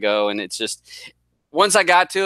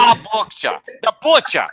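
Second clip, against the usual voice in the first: put-on Boston accent; 8 semitones higher, 3 dB louder than usual. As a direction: say it like a Boston guy. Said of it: "Boston accent".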